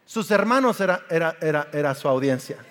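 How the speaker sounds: usual speaking voice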